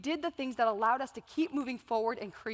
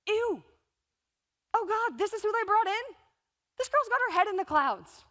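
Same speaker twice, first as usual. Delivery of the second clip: higher, sneering disdain; skeptical audience; 7 semitones higher than usual. Retold or imitated. Imagined audience member sneering at the speaker, higher. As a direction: scoff at the speaker. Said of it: "some of you are sitting here thinking".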